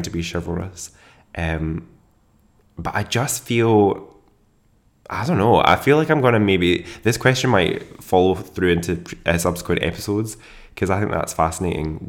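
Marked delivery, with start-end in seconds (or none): none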